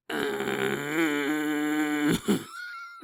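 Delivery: long low grinding groan; boulder sound. Retold or imitated. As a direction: groan like a boulder scraping open. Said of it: "That's the sound of the boulder".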